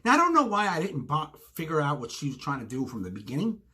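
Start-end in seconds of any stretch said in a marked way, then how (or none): none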